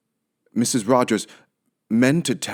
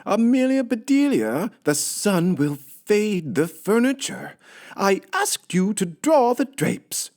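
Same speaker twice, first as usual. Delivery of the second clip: high, slow, exasperated; upset Missus Rogers; 13 semitones higher, 3 dB louder than usual. Acted. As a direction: scold the maid in dismay. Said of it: "said Missus Rogers".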